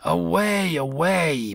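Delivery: gruff barked away; barking dog. A narrator's reading. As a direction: bark it like an old dog. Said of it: "barked the dog".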